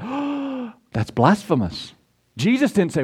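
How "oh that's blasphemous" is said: high, hushed mock outrage, then drops; mock scandalized. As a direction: play the prissy scandalized apostle. Imagined apostles scandalized.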